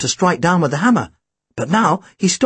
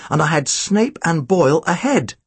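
ordinary delivery, nothing marked

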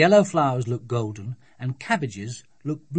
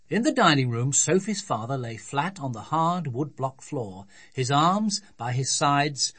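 ordinary delivery, nothing marked

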